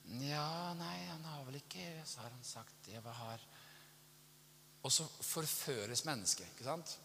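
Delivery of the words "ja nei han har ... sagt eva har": very soft, hesitant, fading voice; doubtful musing. Retold or imitated. Eve unsure what God has said, doubtful musing. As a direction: waver quietly, trailing away. Acted doubt after the temptation.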